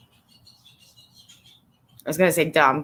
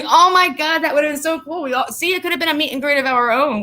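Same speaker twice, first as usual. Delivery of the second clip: excited; 8 semitones higher than usual. Real, not acted. Excited reply to fan.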